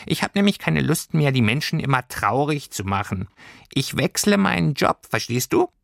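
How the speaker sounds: high, chirpy creature voice; a tiny pelt beast explaining its plans; little furry creature. Acted. Creature telling Lilli it is changing jobs, high and chirpy.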